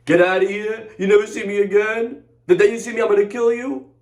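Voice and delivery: high, puffed-up mock threat; mock tough bully. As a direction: play the big man throwing someone out. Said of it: "You all tough? You all big?".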